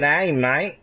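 a lightly put-on Aussie twang on "G'day, mate"; mock Aussie accent. Mocking Australian accent while chatting with a friend: a mild Aussie twang. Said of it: "The Aussie twang".

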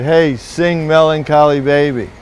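acted yell from a drunk in the audience; drunk heckler's yell. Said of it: "Drunk yells out".

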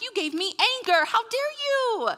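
high-pitched, hammed-up outrage; accuse, then draw out a high indignant finish; playacted indignant outburst; mock anger. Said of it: "I respond out of anger".

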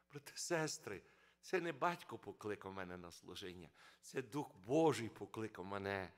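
ordinary talk, nothing marked

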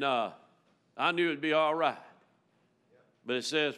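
ordinary talk

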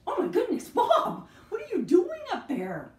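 high surprised cry with a laugh. Acted. Puppeteer surprised to find puppet up high.

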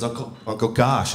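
put-on "Josh" said as "Gosh" by a toddler; toddler mispronunciation. Three-year-old nephew greeting his uncle, mispronouncing Josh as "Gosh". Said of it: "Well, it's Josh, dummy".

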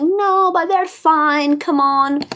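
high, slow brushing-it-off voice; dismissive inner voice. Retold or imitated. Ego to herself, brushing off feeling bad. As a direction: wave the worry away, lilting and slow. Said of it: "And I'm like".

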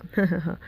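short acted chuckle; light laugh. A narrator's reading. Boy teasing the girl after his confession, light chuckle. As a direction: give a brief, easy laugh. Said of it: "അതും പറഞ്ഞവനൊന്ന് ചിരിച്ചു".

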